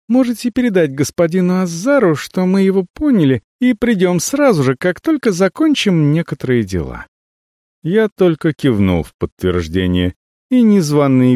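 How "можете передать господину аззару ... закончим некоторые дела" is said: cartoon princess. Avella sweetly answers the visitor.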